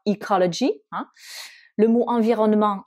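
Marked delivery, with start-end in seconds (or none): 0.1-0.7 ecology with stress on "co", schwa vowels; English pronunciation. Shown as a demonstration. Teacher modelling ecology for French learners. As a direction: say ecology the English way, ee-KOL-uh-jee. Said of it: "en anglais, on va dire".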